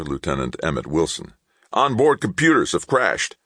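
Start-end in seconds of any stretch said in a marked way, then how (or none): 1.7-3.4 urgent raised shout in a crisis. A narrator's reading. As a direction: shout it up high over the noise. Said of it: "shouted his lead E C M officer".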